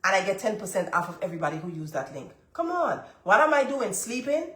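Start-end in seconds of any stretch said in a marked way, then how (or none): none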